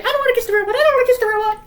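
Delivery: very high, loud mock child protest; Evan. Little brother forced toward the robot, high put-on panicky squeal. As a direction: squeal it like a hammed-up frightened kid. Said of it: "And, and Evan's like".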